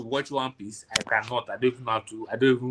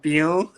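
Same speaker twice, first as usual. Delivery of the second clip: a quick pew imitated from the anime; anime sound effect; 4 semitones higher, 5 dB louder than usual. Friend imitating the anime sound effect, quick pew. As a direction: spit out a short zap noise. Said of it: "It used to be called sound".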